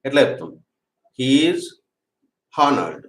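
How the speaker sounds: normal talking voice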